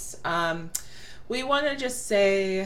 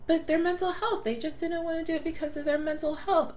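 mocking; 10 semitones higher than usual. Mocking what everyone says.

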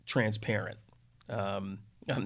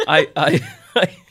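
laughing; 6 semitones higher, 17 dB louder than usual. Real, not acted.

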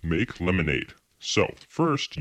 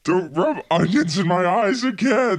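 mock whine; 7 semitones higher than usual. Eyes burning from onions.

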